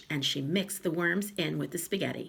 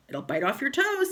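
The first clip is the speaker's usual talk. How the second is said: teasing; 9 semitones higher, 4 dB louder than usual. Acted. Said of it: "said Mister Twit".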